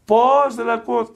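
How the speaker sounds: very high, loud mock-deaf cry. Deaf monk insisting he cannot hear a word, very high shout. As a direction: pitch "Πώς" right up, exasperatedly deaf. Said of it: "το ξανά".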